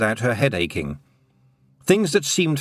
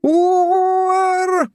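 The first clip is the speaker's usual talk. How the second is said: high, far-off mournful moan; a long wailing poetic ooh; theatrical moan of a would-be poet; 5 dB louder than usual. A narrator's reading. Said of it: "Somewhere a voice went".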